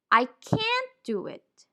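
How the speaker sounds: "can't" pitched high and drawn out; emphatic CAN'T. Shown as a demonstration. Teacher showing how intention changes the word, emphatic can't. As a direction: slowly hit "can't" high, with a full vowel. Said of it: "depending on the intention, the pronunciation also changes".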